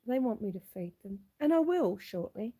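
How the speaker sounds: ordinary talk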